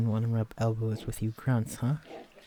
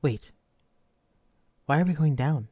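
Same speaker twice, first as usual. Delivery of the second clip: sudden alarm; 5 semitones higher, 6 dB louder than usual. Acted. Notices elevator descends.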